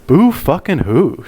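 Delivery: slow, high mocking "boo fucking who"; drawn-out sneer of fake pity; sarcastic. Real, not acted. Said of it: "sarcastic mock sympathy".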